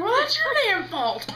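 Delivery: high, loud mock outrage; hammed-up indignant shout; angry retort. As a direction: yell the blame back. Snapping at apologetic stitcher.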